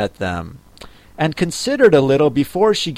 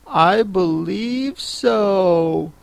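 slow, higher, pondering; thoughtful Alice; 6 semitones higher, much slower than usual. Acted. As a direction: draw it out while thinking it over. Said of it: "Alice replied thoughtfully".

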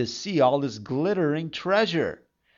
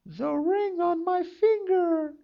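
high maiden voice. A narrator's reading. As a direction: whisper it like a frightened young girl. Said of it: "said she".

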